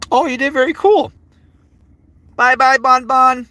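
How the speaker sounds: loud high singsong farewell; cheerful high goodbye. Real, not acted. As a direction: sing out a friendly bye. Saying bye to chatter.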